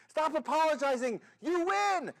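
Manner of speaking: loud, high-pitched outburst; exasperated shout. Acted. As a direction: cut in, then shout the prize news. Manager cuts off the apology.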